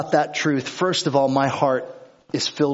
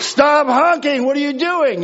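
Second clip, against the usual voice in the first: frustrated yell; 12 semitones higher, 6 dB louder than usual. Retold or imitated. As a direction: yell at the car behind to stop honking. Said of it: "I'm like".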